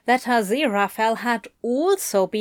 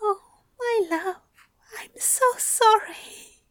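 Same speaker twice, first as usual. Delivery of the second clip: tearful; 11 semitones higher than usual. A narrator's reading. Aziraphale comforts Crowley.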